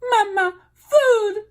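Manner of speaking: very high tiny peep; peeping gosling. A narrator's reading. Gosling calling the robot mama, very high peep. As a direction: peep it like a chick calling mother. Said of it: "said the gosling".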